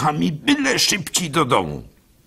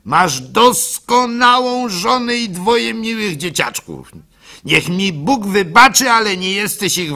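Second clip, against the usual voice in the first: earnest apprentice; 8 semitones higher, 4 dB louder than usual. Acted. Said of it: "mówił".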